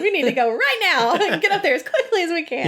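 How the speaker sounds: high, swooping mock urgency; rally them to dash off, pitched high; put-on eager hurry; acted urgent excitement. Voicing urgent plan to run.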